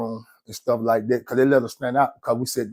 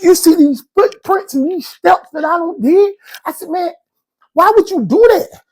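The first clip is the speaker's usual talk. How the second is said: exasperated; 5 dB louder than usual. Retold or imitated. Confronting cellmate.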